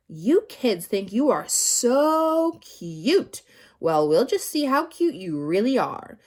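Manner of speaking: loud, slow sneer; sneering threat. A narrator's reading. Teacher sneering at the cute kids. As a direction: taunt the children.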